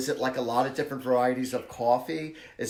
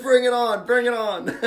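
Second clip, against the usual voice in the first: loud, high mock challenge with laughter; whooping mock-fight taunt, laughing; roughhousing taunt; 8 semitones higher, 9 dB louder than usual. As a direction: shout a playful dare. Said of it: "like, a little roughhousing".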